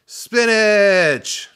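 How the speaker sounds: very high, loud, drawn-out shout; triumphant yell. A narrator's reading. Pupil finishes his count, huge triumphant yell. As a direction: blurt it out at full voice. Pupil bursts out final word.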